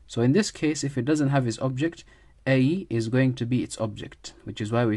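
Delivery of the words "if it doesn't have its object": usual speaking voice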